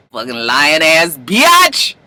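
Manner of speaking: slow, drawn-out jeer; mock insult. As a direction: stretch the insult out, swooping up at the end.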